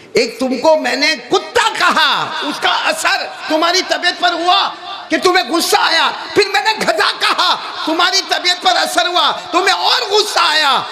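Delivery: high, ringing argument; impassioned cleric proving his case; full-force preaching. Acted. Drives argument home at full force.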